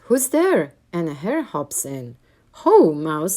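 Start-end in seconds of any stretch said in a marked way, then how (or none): 0.1-0.7 high, loud startled mouse; mouse crying out. A narrator's reading. Mouse calling to the glowing eyes, high and loud. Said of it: "Mouse cries". 2.6-3.4 hearty hare greeting. A narrator's reading. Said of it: "says Hare".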